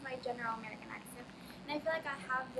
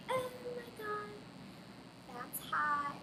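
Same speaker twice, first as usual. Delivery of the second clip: put-on high-pitched Valley girl swoon; Valley girl accent; 8 semitones higher, slower than usual. Shown as a demonstration. Valley girl reaction in accent demo.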